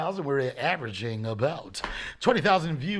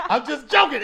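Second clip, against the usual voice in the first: higher, laughing through the words; 7 semitones higher, 5 dB louder than usual. Real, not acted. Host laughing off a joke to co-host.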